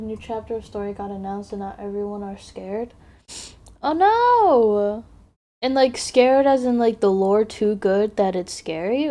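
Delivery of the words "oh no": high, loud oh no; mock alarm. Real, not acted. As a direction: gasp it out in alarm. Reacting to scary lore.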